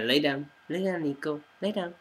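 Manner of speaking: plain reading voice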